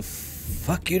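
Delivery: breathy under-the-breath whisper. Real, not acted. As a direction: whisper it to yourself. Streamer's muttered aside at game.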